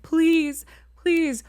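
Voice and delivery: high, loud begging please; pleading. Retold or imitated. Traveler begging the Avis clerk to open, loud pleading. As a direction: plead at the closing door. Begging closing rental office.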